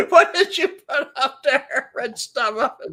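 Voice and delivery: high, laughing question; amused giggly read. Real, not acted. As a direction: laughs while reading the screen. Laughing at the screen.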